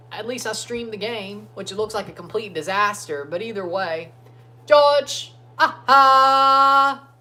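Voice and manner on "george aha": loud, high, held-out cheer; excited shouting. Real, not acted. Fan calling out to batter George, excited shouting. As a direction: belt it out at the batter.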